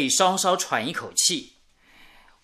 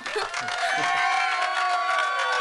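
bashful laugh; 12 semitones higher, 3 dB quieter than usual. Acted. Groom laughing before thanking guests.

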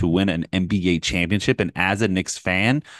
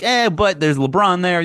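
doubtful shrug; 9 semitones higher, slower than usual. Retold or imitated. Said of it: "And that was also like".

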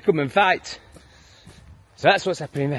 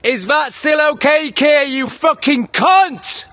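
higher, slow, spat-out insult; furious shout; 8 semitones higher, 4 dB louder, much slower than usual. Real, not acted. Taunting the Prime Minister Keir after his rant, slow furious shout. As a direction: drag out every word in rage.

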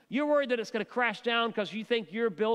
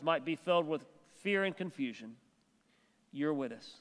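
closing prayer; 3 semitones lower than usual. Acted. Pastor leads closing prayer.

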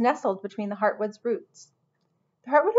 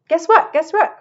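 high, loud excited outburst; excited cry; 9 semitones higher, 9 dB louder than usual. A narrator's reading. Henry bursting with news for the hotel staff, crying out excitedly. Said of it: "cried Henry".

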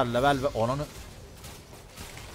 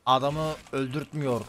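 ordinary talk

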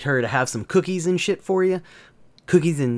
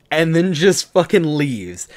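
ordinary talk

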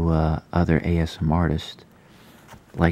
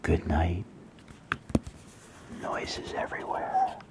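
bedtime story reading; 7 dB quieter, slower than usual. Acted. Reads bedtime story to viewers.

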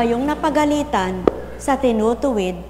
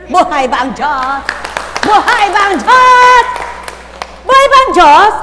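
preacher's rallying cry; 9 semitones higher, 11 dB louder than usual. Real, not acted. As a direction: belt it out to stir the faithful. Preacher's call and response.

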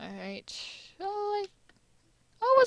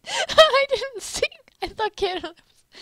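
high squeaky voice; 10 dB louder than usual. Acted.

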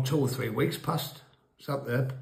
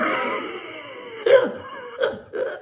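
real cackle, pitched way up; high shrieking laugh. Real, not acted. Teller cracking up at his own story, shrieking laugh. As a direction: burst into helpless laughter.

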